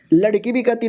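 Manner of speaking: ordinary delivery, nothing marked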